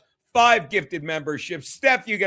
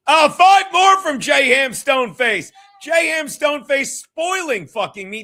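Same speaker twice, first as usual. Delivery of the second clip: shouting higher and louder in delight; excited shout; 7 semitones higher, 8 dB louder than usual. Real, not acted. Host shouting excitedly over a fan's gifted memberships. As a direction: yell it out, thrilled by the gifts.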